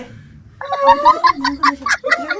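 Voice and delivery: angry yell. Real, not acted. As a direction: yell the threat. Wife yelling at husband.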